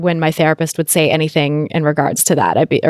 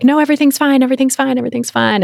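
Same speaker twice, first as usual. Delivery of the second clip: high, bright-sided denial repeated; mock-cheerful insisting all is fine; forced cheer; 8 semitones higher, faster than usual. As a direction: chirp it like everything is fine. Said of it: "conditioned to be like".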